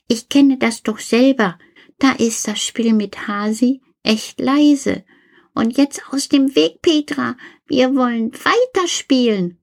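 put-on a know-it-all kid teasing; Karl the toy. Karl shoos Petra away. (Karl, hoch und frech belehrend)

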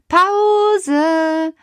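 hoch, gedehnt, sehr langsam; beschwichtigend. Acted. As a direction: dehne das Wort wie ein Zauberspruch. Stoppt den Streit mit Tellern. (Peter beruhigt die Mädchen, gedehnt und viel höher)

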